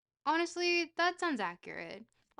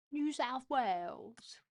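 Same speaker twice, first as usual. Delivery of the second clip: slow, higher New South Wales accent; exaggerated Australian accent; 4 semitones higher, slower than usual. Shown as a demonstration. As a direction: stretch it out in a put-on Australian accent. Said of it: "he doesn't say it like that".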